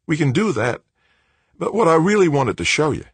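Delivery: put-on high, light young man's voice; young Australian man. A narrator's reading. Director's voice, higher.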